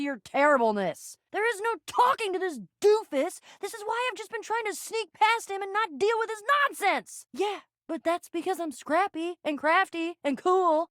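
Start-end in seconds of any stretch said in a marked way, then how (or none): 1.3-7.3 high, fed-up complaint; exasperated. Acted. Complaining about a doofus who won't listen, exasperated. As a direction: pitch it up in exasperation.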